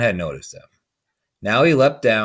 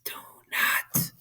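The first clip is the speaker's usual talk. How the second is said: soft unvoiced whisper, slow in places; whispered plea; 10 dB quieter, slower than usual. A narrator's reading. Creature whispering to the sleeping man. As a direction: breathe the plea like a secret.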